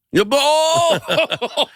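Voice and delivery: high, slow burst of delight; a sudden delighted oh; surprised. Real, not acted. Reacting to punchline timing.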